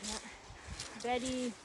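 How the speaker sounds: normal talking voice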